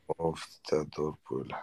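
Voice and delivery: fond slow lullaby-like coo; tender cooing. Real, not acted. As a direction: murmur it lovingly to a little one. Father cooing to his child.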